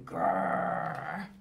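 low mock dinosaur growl. Grandma reading the dinosaur's part, low playful growl. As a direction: growl like a scary dinosaur for kids. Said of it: "the dinosaur's going".

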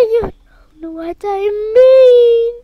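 usual speaking voice

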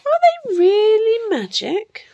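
put-on high-pitched astonished boy; wide-eyed boy. A narrator's reading. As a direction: ask in wonder as young Jack. Jack wonders about the beans.

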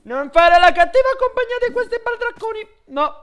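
high, loud hammed-up scold; mock scolding. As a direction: scold her like a fussy parent. Scolding the pet in put-on voice.

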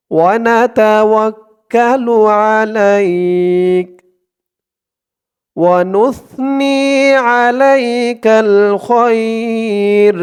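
measured teaching recitation; slow devotional recitation. Shown as a demonstration. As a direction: recite each phrase slowly for repetition. Recitation continues.

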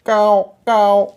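long drawn-out loud caws; slow, stretched crow cries; crow call. A narrator's reading. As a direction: caw twice slowly, high and loud. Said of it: "पुन्हा कावळा ओरडू लागला".

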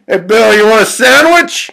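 high, loud holler; full shouted question; shouting. Acted. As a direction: call out to a pal far off. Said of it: "shouts Doug".

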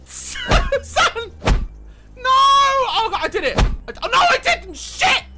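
high, loud frantic outburst; panicked yelling. Pretend. Player yelling at injured game character, panicked. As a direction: cry out in mock-desperate panic.

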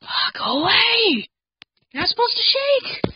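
high crying scream; terrified cry. Real, not acted. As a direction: cry out in terror. Screaming at attack.

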